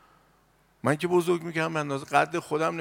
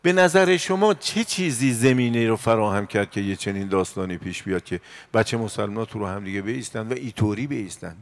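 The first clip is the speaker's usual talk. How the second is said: usual speaking voice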